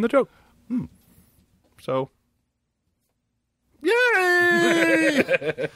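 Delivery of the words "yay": mock cheer. Mock celebration.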